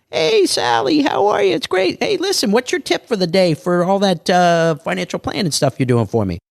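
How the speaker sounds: high, chirpy neighbor chatting over the fence; gossipy neighbor voice. Retold or imitated. Asking a neighbor for money tips, in a high gossipy voice.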